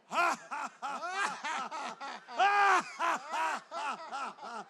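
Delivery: big shouted laugh; hearty laugh for the crowd; loud laugh. Shown as a demonstration. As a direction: let out a full belly laugh. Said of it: "Paul is gonna laugh with me".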